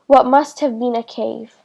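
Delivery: usual speaking voice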